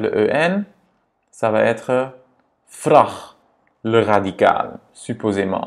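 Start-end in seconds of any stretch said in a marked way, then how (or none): 2.8-3.1 VRAG with a quick, O-like A; short vowel. Shown as a demonstration. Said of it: "c'est pas vrag".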